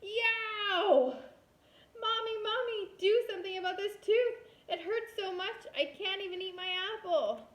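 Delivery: put-on high, loud childish yell of pain; a child wailing loudly at a hurting tooth. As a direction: cry out to Mommy over a sore tooth. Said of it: "and yelled".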